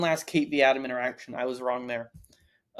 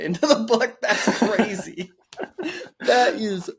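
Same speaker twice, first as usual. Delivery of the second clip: laughing; 5 semitones higher, slower than usual. Real, not acted. Shocked by chapter inclusion.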